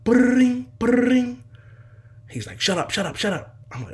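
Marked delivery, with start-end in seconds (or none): 0.0-1.4 high, loud trilled ringing noise; imitated phone ring. Storyteller mimics the long-awaited phone call, high loud ring. 2.6-3.6 high, quick repeated hush; urgent hushing. Retold or imitated. Dad to son as the phone rings, urgent hushing.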